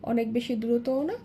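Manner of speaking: ordinary talk, nothing marked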